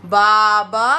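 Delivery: usual speaking voice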